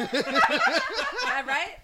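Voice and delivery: slow, loud and laughing; loud laughing guess. Real, not acted. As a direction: call it out over the music. Guessing loudly over headphone music.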